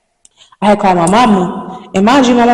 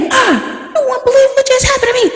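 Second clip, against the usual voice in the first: shocked, rushed. Retold or imitated. Calling mom, shocked and upset.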